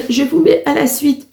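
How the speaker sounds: cracking, unsteady voice. Real, not acted. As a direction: voice breaking up mid-phrase. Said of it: "j'ai la voix qui déraille".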